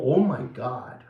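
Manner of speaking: low, breathy-soft awed oh my God; hushed shocked gasp. Retold or imitated. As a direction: murmur it in stunned disbelief. Imitates own shock.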